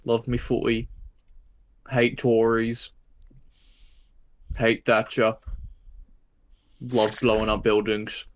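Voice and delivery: lightly put-on British bloke voice; slow, dry British bloke chant; British bloke accent. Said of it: "Oi, Governor Cameron, give me more of your thoughts".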